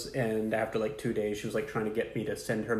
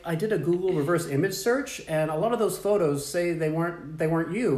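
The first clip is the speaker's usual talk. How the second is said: higher, sweetly naive questioning; mock innocent; 6 semitones higher, 3 dB louder than usual. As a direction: play dumb to the scammer. Acting innocent to scammer.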